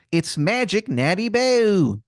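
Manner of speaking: put-on high Maryland accent with drawn-out vowels; Wizard of Baltimore, bad Maryland accent. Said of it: "this man's accent is something".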